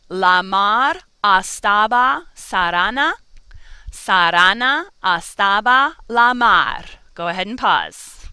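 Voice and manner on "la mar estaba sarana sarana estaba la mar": "La mar estaba sarana" with every vowel an open ah, slow; all vowels as ah. Shown as a demonstration. Teacher models the ah rhyme for students, slow and clear. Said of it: "This is how this first one should sound".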